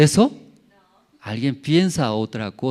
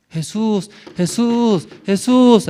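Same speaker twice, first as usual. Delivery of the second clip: rising loud calls, each louder and higher; calling Jesus awake; 9 semitones higher, 6 dB louder, slower than usual. Pretend. Disciples calling the sleeping Jesus in the storm, shouting. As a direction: shout him awake, building. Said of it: "tiene que gritar".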